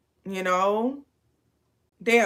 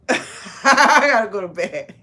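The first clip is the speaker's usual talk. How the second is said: laughing; 4 dB louder than usual. Real, not acted. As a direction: laugh your way through it. Laughing while signing off.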